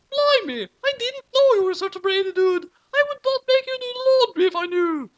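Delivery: high falsetto mother; mom voice. A narrator's reading. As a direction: squeak it like a cartoon mother.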